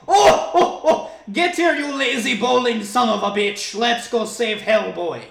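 put-on loud, high Russian-accented bellow; booming stage laugh, then order them out in a Russian accent; hammed-up Russian heroine; mock Russian accent. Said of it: "she just had a thick Russian accent".

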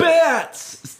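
high, loud amazed cry; amazed exclamation. Acted. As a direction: exclaim it in wonder. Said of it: "Todd exclaims".

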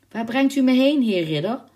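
high, loud, unafraid question; fearless. A narrator's reading. Abducted girl questioning the knight, fearless. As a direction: ask boldly, without a trace of fear. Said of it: "geen spoortje angst in haar stem".

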